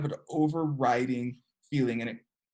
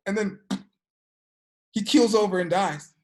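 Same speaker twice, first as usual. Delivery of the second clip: ordinary talk, nothing marked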